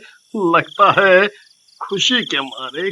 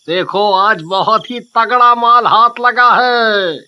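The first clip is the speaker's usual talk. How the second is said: high, crowing thief; triumphant cartoon husband; boastful excitement; 3 semitones higher, 4 dB louder, slower than usual. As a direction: show off the haul proudly. Thief boasts of his haul.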